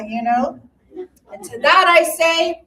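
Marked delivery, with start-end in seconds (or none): none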